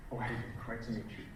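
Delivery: very soft, low, quick greeting; hushed, understated hello; low-volume client. Acted. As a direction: greet someone in a small quiet voice. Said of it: "They're using a low volume".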